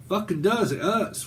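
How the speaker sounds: ordinary talk, nothing marked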